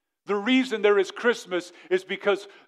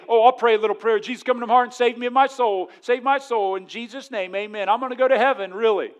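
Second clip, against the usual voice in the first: higher, louder, rushed prayer in a mocking voice; mock shallow prayer; 4 semitones higher, 5 dB louder, faster than usual. Preacher mocking people who pray a quick prayer, higher and louder. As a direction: ham up a careless quick prayer. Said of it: "There are some people say".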